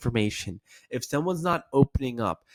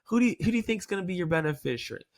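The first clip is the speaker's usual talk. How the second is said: playful; 6 semitones higher, faster than usual. Shown as a demonstration. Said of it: "That playful, ha, ha, tongue-in-cheek tonality".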